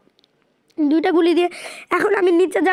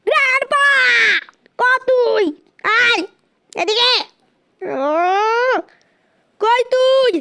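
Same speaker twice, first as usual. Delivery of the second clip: calling out; 4 semitones higher, much slower than usual. Real, not acted. Calling out to hunt grandpa.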